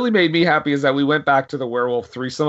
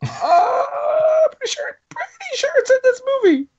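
high-pitched joking shout; squeaky high protest. Real, not acted. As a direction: squeal it out indignantly. Squeaky protest after teasing.

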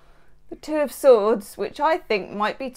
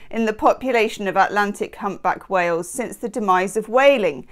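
ordinary delivery

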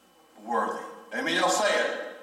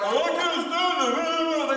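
high, slow, drawn-out whine; mock whine; 10 semitones higher, slower than usual.

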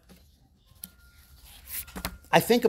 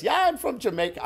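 put-on higher-pitched, half-hearted stab at a Jamaican accent; attempted Jamaican accent; 8 semitones higher than usual. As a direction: imitate a Jamaican woman's accent, then give up. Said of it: "really good Jamaican accent that I'm not gonna try and do".

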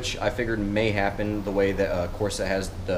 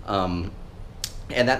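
usual speaking voice

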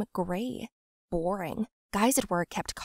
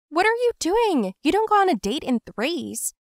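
exasperated protest; 9 semitones higher, 5 dB louder than usual. Acted. Narrator scolding friend.

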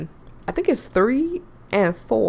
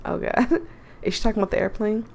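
ordinary delivery

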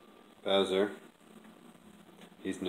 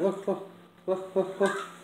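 high call; 10 semitones higher than usual. Real, not acted. Calling cat's attention.

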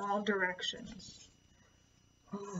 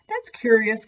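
crestfallen complaint; 7 dB louder than usual. Acted. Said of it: "complained the crestfallen crow".

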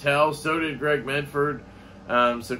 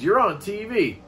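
higher-pitched mock amazement; retold excited fan; 5 semitones higher than usual. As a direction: imitate a fan spotting someone known. Fan spots him, retold.

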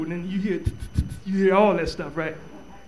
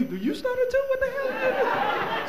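very high and slow, laughing; high falsetto mock shock; mock surprise; 6 dB quieter than usual. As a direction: squeak it in disbelief.

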